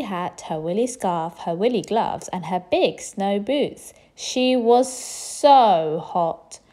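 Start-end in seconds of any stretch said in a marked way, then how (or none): 4.1-6.6 hot and bothered. A narrator's reading. Narrator acts overheating.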